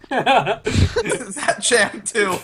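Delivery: slow disbelieving laugh. Real, not acted. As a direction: laugh through the question.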